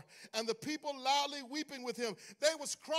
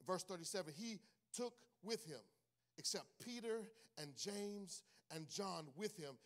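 normal talking voice

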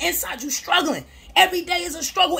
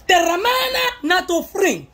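mock preacher; 4 semitones higher than usual. Mock preaching about vices.

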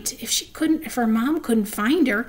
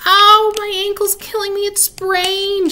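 high, loud fake wail of pain; hammed-up wail; 10 semitones higher, 7 dB louder than usual. Bean faking an injury to her sister, high hammed-up wail. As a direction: overact a sprained ankle. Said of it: "started to wail".

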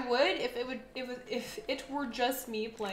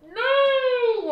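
startled scream; 11 semitones higher, 14 dB louder than usual. Real, not acted. Startled by the monster.

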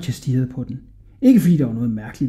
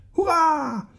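put-on boy's cheer; 3 dB quieter than usual. A narrator's reading. Hodja delighted by the flying carpet, cheering. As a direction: cheer in a high boyish voice. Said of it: "råbte Hodja".

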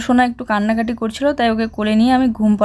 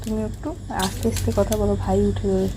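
hushed; 6 dB quieter than usual. Real, not acted. Hushing child near sleeping baby.

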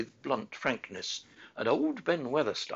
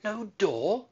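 high, louder startled cry; disbelieving girl crying out; astonished outburst; cried; 9 semitones higher, 5 dB louder than usual. A narrator's reading. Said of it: "cried Mary".

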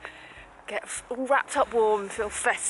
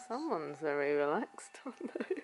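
cooing; 3 semitones lower, 9 dB quieter than usual. Real, not acted. Cooing at relaxed pet.

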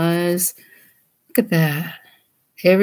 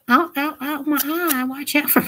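pretend pain; 8 semitones higher than usual. Voicing the drawn figure.

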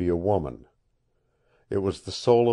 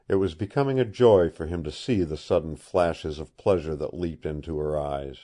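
ordinary delivery, nothing marked

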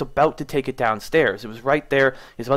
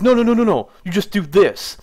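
insistent no; 7 semitones higher, 5 dB louder than usual. Retold or imitated. Tech grabs cord, insisting.

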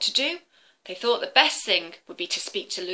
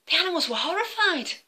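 usual speaking voice

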